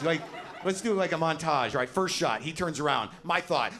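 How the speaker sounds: ordinary talk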